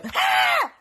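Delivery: piercing high shriek, re-enacted; loud, very high yelp of fright; startled scream. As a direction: scream as if caught hiding. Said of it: "I just go".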